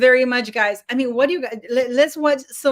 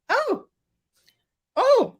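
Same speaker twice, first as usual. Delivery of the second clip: squealed, much higher oh's; excited surprise; 12 semitones higher than usual. Real, not acted. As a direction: yelp with delight at the news. Sudden excitement at arriving guest.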